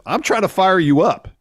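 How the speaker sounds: high, loud rallying cry; mock pep talk. Wrestler firing up his nervous tag partner, loud mock rallying. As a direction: imitate a partner psyching someone up.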